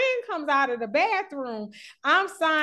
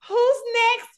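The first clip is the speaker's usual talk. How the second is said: hype-woman friend; 8 semitones higher than usual. Retold or imitated. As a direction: announce the line like a hype woman. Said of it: "she was like".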